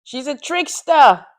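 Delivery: teasing shout. Real, not acted. Friend shouting a tease about the trickster. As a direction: call it out loud to tease her.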